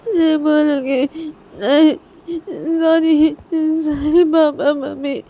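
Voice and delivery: high, wailing mock cry, words dragged out; tearful child. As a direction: play a little girl sobbing out her apology. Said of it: "रोते-रोते". (रोते-रोते, बच्ची की आवाज़)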